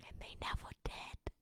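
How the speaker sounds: whispered, barely voiced. Real, not acted. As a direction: breathe it out softly. Confessing old heartbreak.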